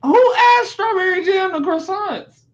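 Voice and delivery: high-pitched incredulous question; appalled disbelief. Real, not acted. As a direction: exclaim it, scandalized by the very idea. Appalled at jam on croissants.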